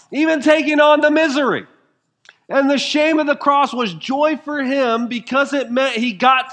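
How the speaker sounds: high, loud preacher's cry; fervent pulpit climax; preaching at full force. Acted. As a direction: lift the congregation with a ringing proclamation. Rising sermon climax on joy.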